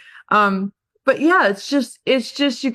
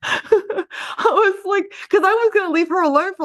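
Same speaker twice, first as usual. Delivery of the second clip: higher, laughing through the words; laughing at myself; 10 semitones higher than usual. Real, not acted. Agent telling the team of her blunder, laughing. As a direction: giggle while confessing the mistake.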